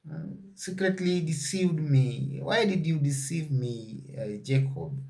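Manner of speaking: hurt but courteous, voice kept down; very polite. Retold or imitated. Laban questioning Jacob, softly and politely. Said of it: "Now he's very polite".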